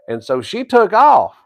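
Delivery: ordinary talk, nothing marked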